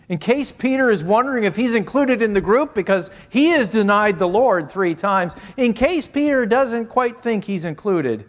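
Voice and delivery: loud, high, urgent pulpit voice; full-force preaching. Real, not acted. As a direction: press it home hard, pitched high.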